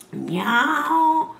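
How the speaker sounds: high, sharp miaow; loud meow. A narrator's reading. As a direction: call out like a cat demanding. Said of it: "disse forte la gatta".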